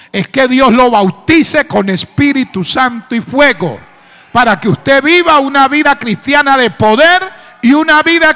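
full-force preaching. Real, not acted. Preacher urging new brethren to seek God, full force. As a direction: build it up to a ringing, high-pitched peak.

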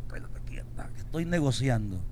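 whispered aside of a mother; hushed, secretive whisper. Acted. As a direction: breathe it out as a secret. Mother's aside, acted quote.

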